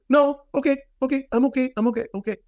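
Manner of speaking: hammed-up high voice of a panicked woman saying yes; flustered lady. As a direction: play the lady quickly giving in. Lady hastily agrees, voiced by teller.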